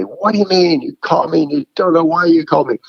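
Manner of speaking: mock the harsh reply without real anger. Imitating a harsh reply to a caller, mock indignant. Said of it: "instead of being harsh and say".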